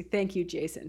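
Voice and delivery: normal talking voice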